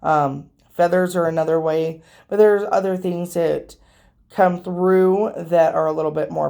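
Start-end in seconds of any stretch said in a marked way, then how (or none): none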